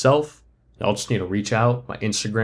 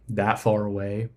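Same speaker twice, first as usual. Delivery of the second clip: normal talking voice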